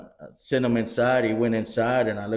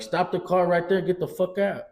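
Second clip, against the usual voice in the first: higher, barked commands; yelling orders; 7 semitones higher than usual. Retold or imitated. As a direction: order him out of the car. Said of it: "I'm like".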